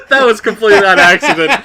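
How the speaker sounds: laughing while explaining; giggly, loud and high. Real, not acted. Still laughing at himself.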